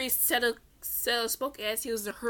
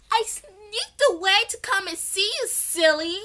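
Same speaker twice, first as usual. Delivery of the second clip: put-on higher, louder teasing girl; playful girl; 10 semitones higher, 6 dB louder than usual. Mana tells Atom she sneaked away, playful. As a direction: tease him playfully.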